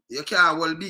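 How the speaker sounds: normal talking voice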